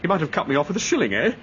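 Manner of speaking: usual speaking voice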